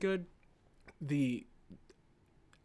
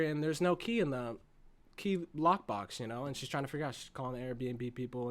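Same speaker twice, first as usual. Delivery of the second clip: ordinary talk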